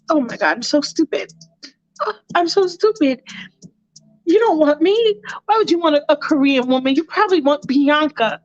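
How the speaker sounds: high, self-pitying mock lament; mortified woman. Allison feeling rejected by Michael, high mortified lament. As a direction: whine in embarrassment, rising high. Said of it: "And she's like".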